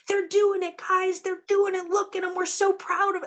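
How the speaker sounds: proud ancestors cheering from the stands. Retold or imitated. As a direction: gush like proud relatives in a stadium.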